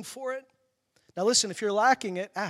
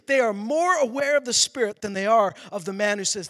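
higher, louder sermon push; fired-up preaching; 5 semitones higher, 7 dB louder than usual. Acted. Pastor urging the congregation, fired-up preaching. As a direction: drive the point home to the crowd.

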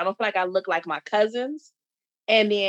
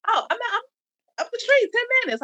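high-pitched, stumbling mock-innocent reply; halting high-voiced quote of oneself; retold hesitant answer; 13 semitones higher, much faster than usual. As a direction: act out a tentative answer, pitched well up. Retold wary answer to a stranger.

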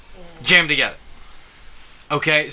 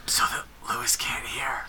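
secretive hushed whisper; 3 semitones lower, 8 dB quieter than usual. Pretend. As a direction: whisper so he can't hear. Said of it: "Why, why are we whispering?".

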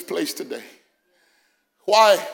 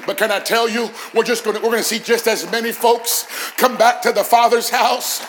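loud, high pulpit crescendo; rising preacher's roar to the congregation; full-force preaching; 7 semitones higher, 9 dB louder than usual. Real, not acted. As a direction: build to a shouted, triumphant climax.

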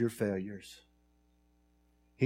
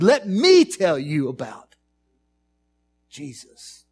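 slow, loud declaration that drops to a hush on Jesus; bold Peter; 4 semitones higher, 9 dB louder, slower than usual. Pretend. Peter testifying before the Sanhedrin court, loud then hushed. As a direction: speak up boldly for him, then breathe the name. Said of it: "and he says".

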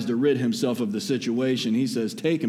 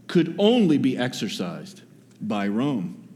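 normal talking voice

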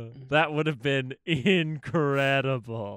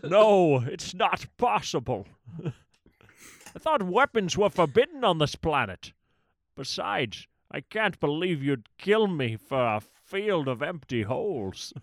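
movie quote; 3 semitones higher, 3 dB louder than usual. Retold or imitated. Quoting Toothless Joe.

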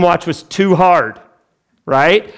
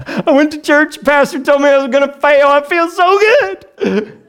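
much higher, sarcastic gushing; mock delight; 11 semitones higher, 3 dB louder than usual. Pastor playing a churchgoer told he'll fail, high mock delight. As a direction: gush it with laughing irony. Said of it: "Isn't that encouraging?".

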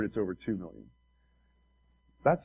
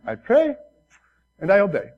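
put-on Korean accent; 7 semitones higher, 6 dB louder than usual. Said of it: "in his broken Korean accent".

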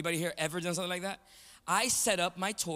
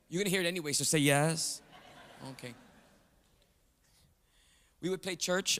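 usual speaking voice

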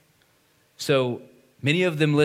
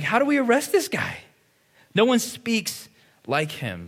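higher, astonished voice of a bewildered guard; marveling officers; 9 semitones higher than usual. Retold or imitated. Officers reporting back to Pharisees, astonished and higher. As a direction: gasp out the wonder, much higher. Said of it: "officers are, are marveling at him".

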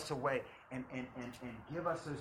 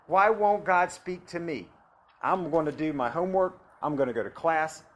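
preaching at full force; 3 semitones higher, 12 dB louder than usual. Acted.

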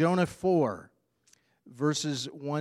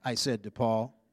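put-on lively, louder storybook voice of a grumpy boy; reading to children; 4 semitones higher, 9 dB louder than usual. Preacher reading Alexander's story to congregation, animated. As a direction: read it like a cranky kid telling his bad day.

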